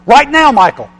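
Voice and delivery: loud, high voice of God rebuking; God's rebuke, loud and high. Retold or imitated. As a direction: speak as God cutting in sharply. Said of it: "And now God's saying to me".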